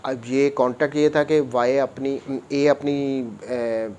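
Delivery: ordinary talk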